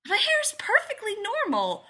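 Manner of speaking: put-on high, loud, indignant child; affronted. A narrator's reading. Joe answering Jim's remark on long hair, affronted. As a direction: protest in an offended little-girl voice.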